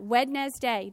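"Wednesday" as wed-nes-day, every written sound heard; "Wednesday" sounded out just as it is spelled; spelling pronunciation. Shown as a demonstration. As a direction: pronounce the silent d in "Wednesday". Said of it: "think differently to spell a word than how we actually pronounce it".

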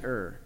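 "er" with the vowel of "her"; correct pronunciation. Shown as a demonstration. Teacher correcting how E R R is said, correct pronunciation. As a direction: say "er" to rhyme with fur. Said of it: "It's actually".

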